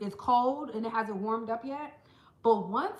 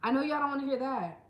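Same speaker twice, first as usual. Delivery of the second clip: normal talking voice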